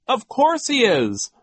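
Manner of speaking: high, loud and slow outburst; exasperated cry. A narrator's reading. Violet snapping back at Mister Poe, loud exasperated cry. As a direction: cry it out, fed up at last. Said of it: "Violet cried".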